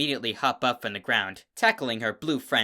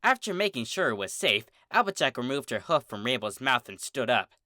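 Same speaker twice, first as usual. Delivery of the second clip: ordinary delivery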